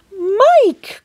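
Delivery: high alarmed cry of a name. A narrator's reading. Mother crying out for her shrunken son, high alarmed cry. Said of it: "cried Missus T V".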